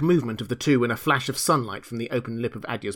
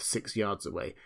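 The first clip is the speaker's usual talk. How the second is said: ordinary delivery, nothing marked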